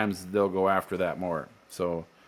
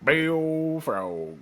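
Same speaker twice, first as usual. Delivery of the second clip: put-on slow, higher drawled accent; hammed-up country drawl; 5 semitones higher, much slower than usual. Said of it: "how you can read it in that accent".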